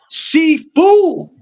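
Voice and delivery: very high-pitched hail; calling out. Real, not acted. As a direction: sing out the coach's nickname in a high call. Hailing the coach by nickname.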